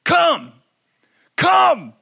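put-on loud, high toddler command; three-year-old's power voice. Little boy orders the big dog to come, mock commanding shout. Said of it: "Malachi would be like".

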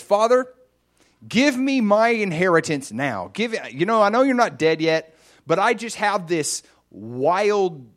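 loud, high, insistent retold demand; acting out an entitled, urgent plea; demanding son. Son demands inheritance from father.

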